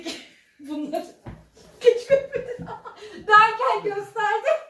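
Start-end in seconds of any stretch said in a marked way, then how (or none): 1.8-4.6 loud, high laughing. Real, not acted. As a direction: talk through a laughing fit. Laughing fit while tickled. (kahkahalar arasında yüksek sesle)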